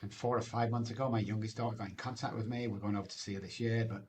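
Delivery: ordinary delivery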